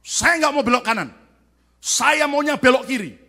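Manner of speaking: a touch of obstinate refusal; stubborn insisting. Pretend. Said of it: "Tapi saudara ngotot".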